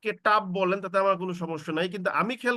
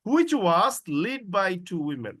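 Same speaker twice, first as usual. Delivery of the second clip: slow clause together; much slower than usual. Shown as a demonstration. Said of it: "একসঙ্গে পড়তে হবে".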